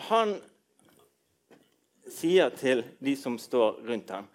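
usual speaking voice

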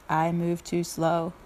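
low, slow turtle voice. Acted. Turtle declines to deliver invitations, slow and low. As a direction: drag it out low, like a plodding turtle. Turtle's take beside rabbit's.

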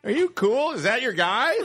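hammed-up clueless comic shouting; shouting out things. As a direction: holler it at an imaginary crowd. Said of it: "Just shouting out things".

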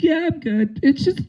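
put-on high, strained voice of a wounded kid; dying kid voice. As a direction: play the hurt kid brushing it off.

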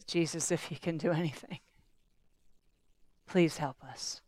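soft, low, hushed plea; pleading father. Acted. A desperate father begging Jesus for help, hushed and low. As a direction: beg quietly, almost out of hope.

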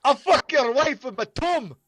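high, loud comic insult; high mock yell; mock Scottish threat yelled. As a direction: hurl it like a drunk Scot. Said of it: "what he kept saying".

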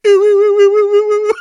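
high sung spooky ooh; ghostly wooing. Retold or imitated. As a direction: wail like a cartoon ghost. Ghostly invisibility noise.

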